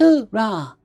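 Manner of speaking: high, contained mock shout; otters' shout. A narrator's reading. Startled otters copying the Marines' cry, high and lightly shouted. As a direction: echo the cry in a small high voice. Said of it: "but then shouted".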